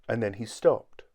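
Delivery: ordinary delivery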